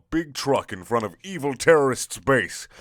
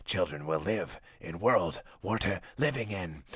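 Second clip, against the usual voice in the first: lower, much softer earnest hero monologue; a hushed, self-serious hero's moral speech; solemn hero speech; 6 semitones lower, 9 dB quieter than usual. Acted.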